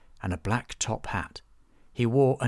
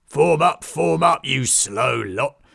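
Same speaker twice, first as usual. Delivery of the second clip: much louder, slow booming command; booming ringmaster; 6 semitones higher, 8 dB louder, slower than usual. Acted. Ringmaster summoning all performers, booming loud. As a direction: boom it across the whole camp. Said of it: "His voice tore through the crowd".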